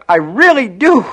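high, loud, desperate begging; frantic child, cut off mid-plea; imploring cry. A narrator's reading. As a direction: implore your brother in panic. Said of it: "held his hands out imploringly".

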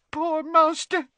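a first tearful murmur; weepy elf. A narrator's reading. Elf weeping for her master.

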